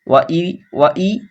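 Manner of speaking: normal talking voice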